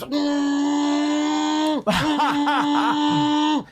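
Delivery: high humming buzz imitating a vibrating phone; buzzing hum.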